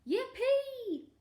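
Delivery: high, loud whoop of joy; gleeful storybook whoop; jubilant cry. A narrator's reading. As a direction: cheer right up at the top of the voice. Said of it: "cried out".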